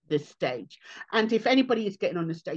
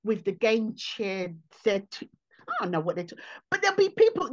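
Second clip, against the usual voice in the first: ordinary delivery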